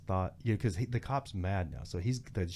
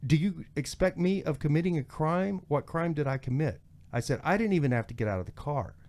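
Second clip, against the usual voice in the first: emphatic, higher-pitched argument; retold indignant protest; 5 semitones higher, 3 dB louder than usual. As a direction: re-enact standing up to the officer. Arguing back at deputy, retold.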